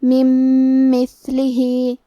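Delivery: من مثلہ with the two mims fused into one long nasal hum; من مثلہ slowly, mim merged with ghunna; merged ghunna demo. Shown as a demonstration. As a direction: hold the doubled mim of من مثلہ nasal and stretched, very slow. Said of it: "غنہ اور تشدید کے ساتھ ادا کریں گے".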